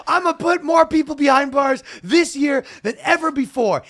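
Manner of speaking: high, loud prosecutor bluster; mock tough-guy boast. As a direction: boom it like a campaign promise. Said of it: "And he goes".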